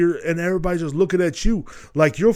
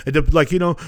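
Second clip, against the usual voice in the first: ordinary delivery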